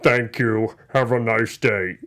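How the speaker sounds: low, slow robot voice; flat polite killer robot. Retold or imitated. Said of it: "the robot says".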